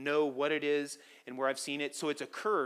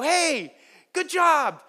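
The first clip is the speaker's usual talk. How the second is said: high, loud fake-bright hello; cheery greeting; 12 semitones higher, 9 dB louder, much slower than usual. Pastor greets girl whose name he forgot, overly cheerful. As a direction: cover not knowing her name with cheer.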